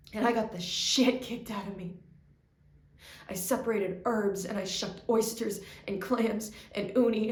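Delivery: loud, driven and relentless; intense loud rant. Real, not acted. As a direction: pour it all out hard.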